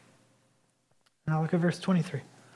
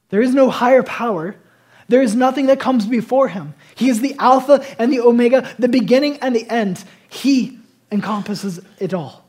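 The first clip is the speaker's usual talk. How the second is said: loud, high, ringing sermon voice; proclaiming God's power to the congregation, preaching at full force; 5 semitones higher, 9 dB louder than usual. Real, not acted. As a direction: build the crescendo like a revival preacher.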